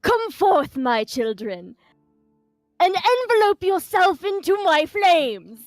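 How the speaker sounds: loud, slow, booming menace; dark menacing voice. Pretend. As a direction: boom it down the chimney like a demon. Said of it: "assume this really dark, menacing, like, voice".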